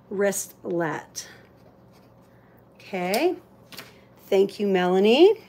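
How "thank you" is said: ordinary talk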